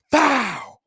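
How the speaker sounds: sudden high loud shout. Real, not acted. Recapping Lucky Roo's shot, sudden excited shout. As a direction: yell it out in shock. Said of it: "shouted exclamation".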